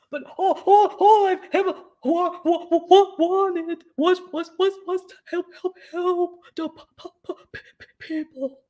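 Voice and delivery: high, stammering mock voice, laughing through it; mock stuttering. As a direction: ham up a nervous stutter to mock him. Mocking a troll's stuttering.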